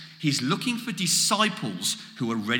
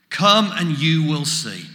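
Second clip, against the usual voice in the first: slow, louder "Come and you will see"; emphatic invitation; 5 dB louder than usual. Acted. Preacher repeats Jesus' response to the congregation, slow and emphatic.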